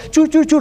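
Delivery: high, rhythmic "chu, chu, chu"; playful high-pitched chugging; chugging train imitation. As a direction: answer like a children's choo-choo train. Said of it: "responde el tren".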